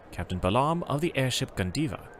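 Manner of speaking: ordinary talk, nothing marked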